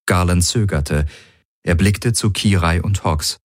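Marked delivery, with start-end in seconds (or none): none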